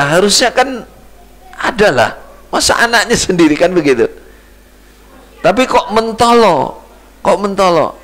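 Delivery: impassioned pulpit delivery with rhetorical questions; preaching at full force. Acted. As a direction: raise the voice and press the congregation.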